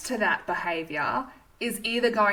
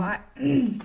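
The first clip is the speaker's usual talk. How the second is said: usual speaking voice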